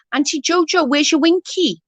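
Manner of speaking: put-on high, innocent little boy asking; child's voice. Aunt voicing her small nephew asking innocently, high child voice. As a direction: pitch way up like a curious toddler.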